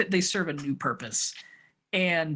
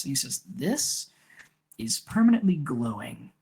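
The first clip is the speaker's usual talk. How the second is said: lower, hushed conspiratorial recruiter; Tyment's low scheming voice; 5 semitones lower than usual. Acted. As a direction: lean in and confide the secret mission.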